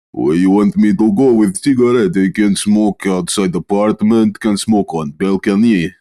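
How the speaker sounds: put-on lightly done Russian accent, dropped articles. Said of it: "wake up with a Russian accent".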